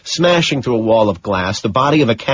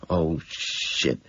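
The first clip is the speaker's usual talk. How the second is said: low, much softer muttered curse; 5 semitones lower, 10 dB quieter than usual. A narrator's reading. Killer muttering a curse at the panicking driver, low and soft. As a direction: grumble it under your breath. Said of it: "I mutter".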